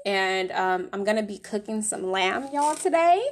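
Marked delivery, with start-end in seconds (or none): 2.5-3.2 higher playful Southern country twang on y'all, today; country accent. Acted. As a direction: throw on a little country accent for fun. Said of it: "the little country accent".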